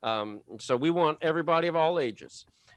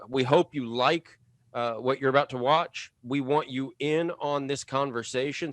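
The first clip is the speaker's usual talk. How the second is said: normal talking voice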